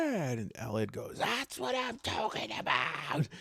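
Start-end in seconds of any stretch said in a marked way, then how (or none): 1.2-3.3 high, put-on voice of delight; excited trainer. Trainer Elliot cheering his trainee's hit, very excited. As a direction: crow it high, without extra volume. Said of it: "he's very excited".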